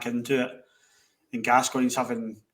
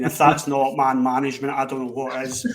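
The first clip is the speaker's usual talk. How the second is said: ordinary talk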